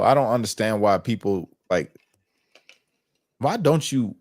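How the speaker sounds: usual speaking voice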